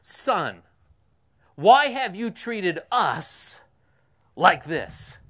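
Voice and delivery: loud, slow, pleading reproach; anguished mother's rebuke. Retold or imitated. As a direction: drag out the why like a frantic mother. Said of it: "now I'm quoting from the scriptures".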